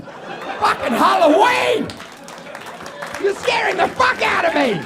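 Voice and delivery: high, drawn-out comic alarm; mock horror. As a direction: cry out as if spooked, hammed up. Mock horror at surgery result.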